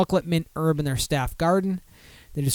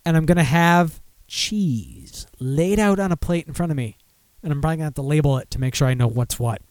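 normal talking voice

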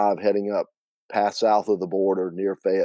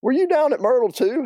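high-pitched Southern aunt; chirpy, high and loud reply; Aunt Hallie; 4 dB louder than usual. Acted. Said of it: "do my best Aunt Hallie and Linda".

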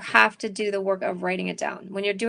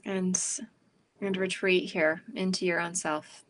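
calm, unhurried breathing guidance; guided meditation; 3 semitones lower, 5 dB quieter than usual. Acted. Leads a meditation.

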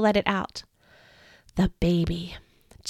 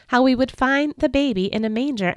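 usual speaking voice

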